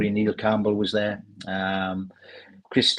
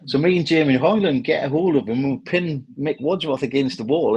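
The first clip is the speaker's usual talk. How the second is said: ordinary talk, nothing marked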